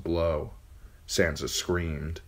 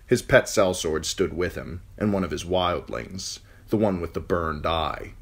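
ordinary delivery, nothing marked

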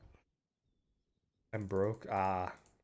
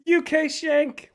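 excited shout; 13 semitones higher, 8 dB louder, much slower than usual. Real, not acted. Repeats the win.

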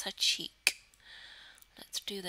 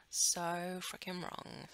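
slow disapproval; 2 semitones lower, much slower than usual. Real, not acted.

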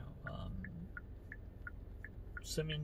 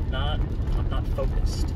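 normal talking voice

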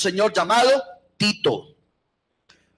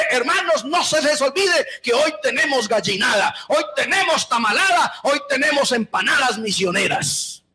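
mock announcer; 7 semitones higher than usual. The preacher mocks a leader who announces the church food sale, shouting. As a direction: ham up the oblivious worship leader.

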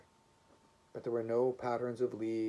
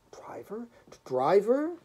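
whispered, then high and loud; chattering; 12 semitones higher, 8 dB louder, slower than usual. A narrator's reading. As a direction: stammer it out in feverish alarm. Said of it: "he chattered".

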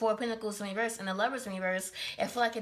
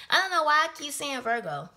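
exasperated outburst; 5 semitones higher, 6 dB louder than usual. Real, not acted. Exasperated at own slip.

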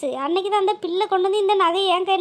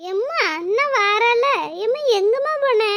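put-on higher, slow child's voice calling out for mom; 4 semitones higher than usual. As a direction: call for mom like a small kid. Children call their mother.

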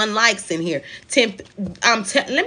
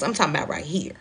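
hushed, breathy whisper; 6 semitones lower, 7 dB quieter, much faster than usual. Real, not acted. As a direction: drop to a whisper to make the point. Stresses a point by dropping to a whisper.

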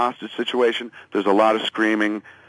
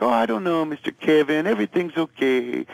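higher-pitched, imitated non-native English accent; 5 semitones higher than usual. Raina nervously answers Kevin about the police, in imitated accented English. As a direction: imitate someone with limited English, voice lifted. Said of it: "She doesn't speak much English".

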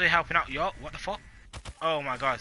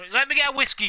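high, louder playful call; 9 semitones higher, 5 dB louder, faster than usual. Pretend. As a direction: play up the whiskey line.

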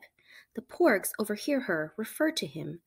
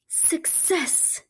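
triumphant; 10 semitones higher than usual. A narrator's reading. Chewie finally finds food.

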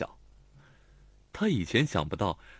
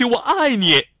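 much higher, much louder declaration; tearful shout; 13 semitones higher, 12 dB louder, slower than usual. A narrator's reading. Lanlan shouts her love at Xiao Tong, tearfully. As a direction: fling out the confession through tears. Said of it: "她红着眼叫喊".

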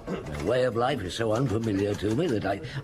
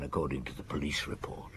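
whispered aside; 7 semitones lower, 7 dB quieter, faster than usual. Acted. Inspector doubting the cancer story, whispered aside. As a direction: drop to a low whisper.

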